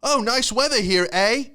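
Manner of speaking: high, loud chirpy Canadian small talk; mock Canadian. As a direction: put on a friendly mock Canadian. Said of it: "these Canadians buying houses here".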